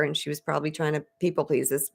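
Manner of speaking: ordinary talk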